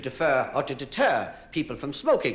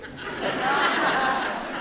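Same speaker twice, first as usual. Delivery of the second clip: laughing; 11 semitones higher than usual. Real, not acted. Laughs after punchline.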